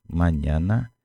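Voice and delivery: ordinary talk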